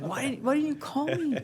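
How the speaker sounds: mock-indignant high plea; exasperated. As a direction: replay the frustration, voice jumping way up. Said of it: "I'm like".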